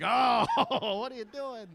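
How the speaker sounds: a hint of a recoiling wife, laughing; mock the wife recoiling with a laugh; disgusted wife. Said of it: "The best reaction I ever get".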